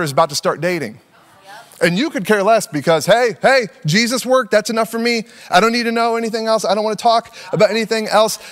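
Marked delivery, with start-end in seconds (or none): 3.1-8.4 higher, insistent refusal; mock defensive brush-off. Young believer waving off tough questions, mock defensive. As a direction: cut off the discussion, insistent.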